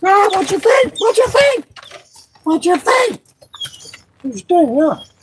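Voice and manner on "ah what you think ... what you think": higher, teasing voice to the fish; fish-talk pet voice; playful high voice. Pretend. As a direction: ask it like talking to a pet. Talking to the fish in a voice.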